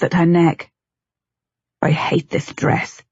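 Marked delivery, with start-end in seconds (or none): 1.8-3.0 low, slow, breathy mutter under his breath. A narrator's reading. Husband undressing his wife, grumbling in a slow, soft mutter. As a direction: grumble it quietly to yourself. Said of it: "he muttered as he stripped it off".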